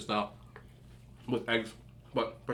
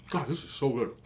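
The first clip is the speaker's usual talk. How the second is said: mouth full; 3 dB quieter than usual. Real, not acted. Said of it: "talking my mouth full".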